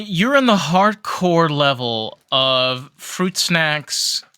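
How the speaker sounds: ordinary talk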